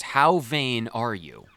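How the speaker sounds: ordinary talk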